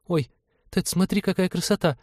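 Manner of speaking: put-on high, girlish wonder; high-pitched marveling young woman; awed girl's exclamation. A narrator's reading. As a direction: gasp in delight at something pretty, high voice. Girl marvels at a butterfly.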